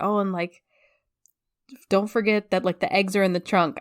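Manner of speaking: ordinary delivery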